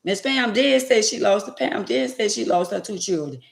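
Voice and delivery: normal talking voice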